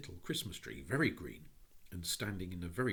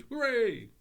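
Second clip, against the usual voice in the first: put-on high, loud children's hooray; cheering shout; 12 dB louder than usual. A narrator's reading. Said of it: "the English children shouted".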